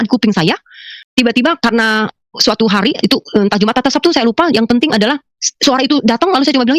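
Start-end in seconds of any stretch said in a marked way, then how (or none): none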